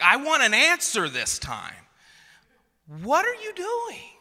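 high, loud hammed-up demand; mock-exasperated dad climbing to a squeal; frustrated. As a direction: demand an answer, pitch shooting up. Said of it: "Like, frustrated".

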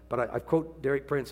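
normal talking voice

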